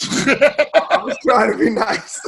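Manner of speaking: high, slow words through laughter; laughing protest. Real, not acted. Laughs while defending himself after teasing.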